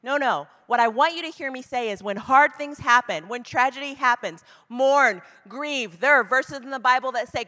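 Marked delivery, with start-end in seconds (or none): none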